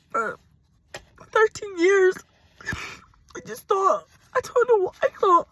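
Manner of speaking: high, loud, breaking sobs; furious tears about the idiot she stayed with; angry crying. Real, not acted. Tearful anger at ex-partner.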